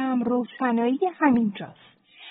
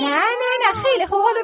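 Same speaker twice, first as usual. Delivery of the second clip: quick higher nos to calm; higher, hurried comfort; hurried reassurance; 9 semitones higher, 4 dB louder, faster than usual. Acted. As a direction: rush to soothe the child. Aunt calms frightened child.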